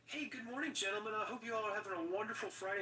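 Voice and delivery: high, sugary cheerful greeting; mock chipper politeness, high and fast; overly polite Corey. Tattoo artist imitating Corey greeting coworkers, high and chirpy. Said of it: "Corey's like".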